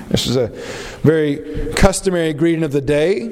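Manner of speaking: usual speaking voice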